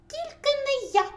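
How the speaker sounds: very high, loud squeaky yell; shouting mouse. A narrator's reading. First mouse refuses the rooster's chore, squeaky shout. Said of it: "закричав Круть".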